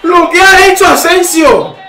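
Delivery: very high loud cry of wonder; amazed shout. Real, not acted. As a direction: shout in disbelief. Amazing save by Asensio.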